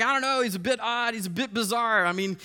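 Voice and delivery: mock the crowd's suspicious whispering-turned-chatter; gossiping crowd. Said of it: "and they're like".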